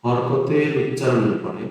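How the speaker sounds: slow, dictating while writing; slow dictation to students. Shown as a demonstration. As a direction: spell out each word as you write. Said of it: "লিখে রাখছি".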